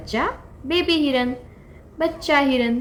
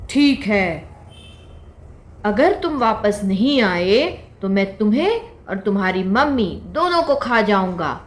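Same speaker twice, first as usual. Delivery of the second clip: lion threatens the fawn and its mother; stern lion; 4 dB louder than usual. Acted. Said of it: "तो उसने हिरन के बच्चे को बोला". (गंभीर शेर की चेतावनी)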